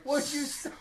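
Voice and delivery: high-pitched question through a laugh; mock shock, holding in laughter. Mother trying not to laugh at son, stifled mock shock. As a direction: ask it high, fighting back giggles.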